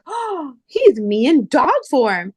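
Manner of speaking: high, slow doting gush. Retold or imitated. Owner doting on a Pomeranian puppy, high and slow. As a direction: melt over the little dog.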